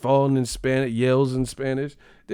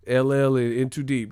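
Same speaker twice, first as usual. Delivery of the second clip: ordinary talk, nothing marked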